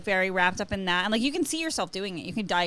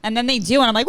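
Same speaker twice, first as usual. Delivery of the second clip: ordinary delivery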